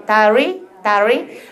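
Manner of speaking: 다리 with a gliding, untapped r; English r. Shown as a demonstration. Teacher modelling English for trainees, 다리 with untapped r. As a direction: keep the tongue off the ridge in 다리. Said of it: "영어는 다면 절대 안 돼".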